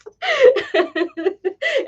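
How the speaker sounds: a long high burst of laughter. Real, not acted. Laughing at her toddler self falling down stairs.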